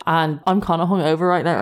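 ordinary talk, nothing marked

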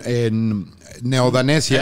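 "Neodanesia" drawn out very slowly with a stretched "en"; mock solemn name. Host proudly naming the new fake country, slow mock-grand delivery. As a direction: savour each syllable of "Neodanesia" like a pompous anthem. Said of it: "yo estoy muy orgulloso de".

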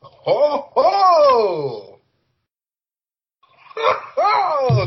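high, loud whooping; excited hoots. Real, not acted. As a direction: hoot with glee. Teasing Finn Balor reveal.

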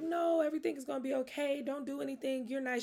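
soft, soothing easygoing friend; calm her down gently like the angel friend. Retold or imitated. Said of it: "like, easygoing".

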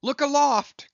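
very high, loud shout; cry of alarm. A narrator's reading. Starbuck crying out to the crew, high and loud. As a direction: yell upward in awe and alarm. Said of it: "cried Starbuck".

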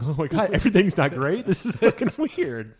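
high, loud retold disbelief, slowing at the end; mock shocked realization. Host recalling movies being ruined, mock shock. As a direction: act out your younger self's stunned discovery. Retelling childhood shock at films.

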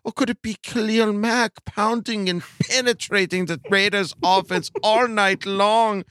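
put-on high, leering German-accented falsetto; campy, high-pitched German creep; German pervert. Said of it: "the German perverted voice".

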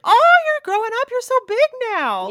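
put-on high sing-song adult cooing at a growing child; cooing at a kid. Adult imitating what people say to kids, high cooing. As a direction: coo it up high like greeting a child you haven't seen in a while. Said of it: "just like".

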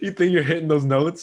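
high, laughing teasing question; laughing tease. Real, not acted. Friend teasing his singing while laughing. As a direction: tease him through laughter. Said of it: "teasing while laughing".

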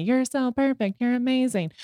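mock flattery. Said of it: "the rest of the world telling me".

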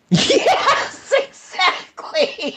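very high, squealed laughing agreement. Real, not acted. Co-host delighted by the cousin complaint, laughing hard. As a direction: crack up while agreeing, stretching the words.

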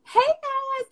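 bright high put-on cheer to the kids; forced happy hello; fake cheerful greeting. Mom greeting her kids, fake smile on. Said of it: "you put your fake smile on".

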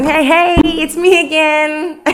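high, drawn-out singsong greeting; stalkerish salesperson. Acted. Salesperson checking in on a client yet again, stalkerish. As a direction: pop up again with a pushy, high hello. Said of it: "gotta be a little stalkerish".

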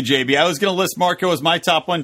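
usual speaking voice

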